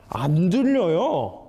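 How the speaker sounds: usual speaking voice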